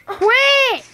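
high loud quit; angry shout. Real, not acted. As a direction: bark at friend to stop. Yelling at friend to stop.

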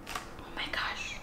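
breathless hushed whisper; whispered gasp. Pretend. Reader building suspense for children, soft whisper. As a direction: lean in and whisper it.

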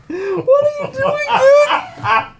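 high, squealed question through laughter; helpless high-pitched laughing words. Real, not acted. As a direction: crack up while asking, drawn out. Cracking up at video.

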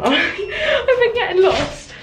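high, slow laugh through the words; laughing at herself for getting lost. Real, not acted. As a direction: giggle it out, embarrassed.

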